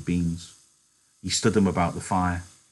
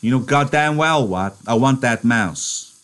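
a high, loud demanding voice for George; 6 semitones higher, 5 dB louder than usual. Acted. George demanding the mouse from Lenny, insistent. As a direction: press hard for the mouse.

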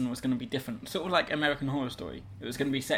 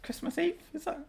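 put-on high child voice; 13 semitones higher than usual.

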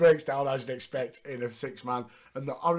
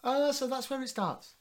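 high knowing voice; 10 semitones higher than usual. Retold or imitated. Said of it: "and like".

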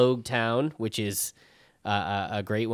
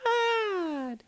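hushed gasp rising to a high wail; drawn-out dismay; much slower than usual. Acted. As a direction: stretch it out into a squeaky falsetto lament. Reacting to a brutal scene.

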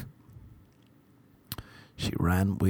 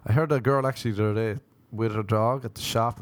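ordinary delivery

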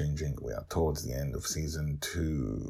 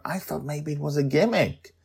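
eager hostess Sandra; 11 semitones higher than usual. Acted. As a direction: fuss over guests, voice up high. Sandra voiced.

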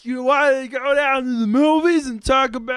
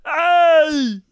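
usual speaking voice

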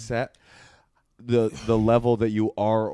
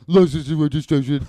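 comic mimicry that shows no respect for the cop; mocking imitation; 2 semitones higher, 4 dB louder than usual. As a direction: parrot the police request back in a silly voice. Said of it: "they'll just be like".

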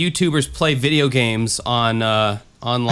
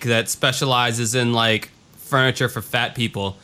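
normal talking voice

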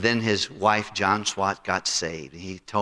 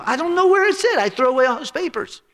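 very high, louder dismissive voice; friend shrugging it off; 6 dB louder than usual. Retold or imitated. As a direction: brush it off in a squeaky put-on voice. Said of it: "He said".

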